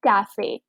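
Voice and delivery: कैफे said with a clear final "e", kaa-fay; कैफे with the e heard at the end; pronounced final e. Shown as a demonstration. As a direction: sound the ending of कैफे fully. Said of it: "यहाँ पर ई की pronunciation है".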